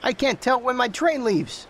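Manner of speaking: high, put-on complaining voice; a fussy man fretting about his train; high whiny passenger; annoyed traveller.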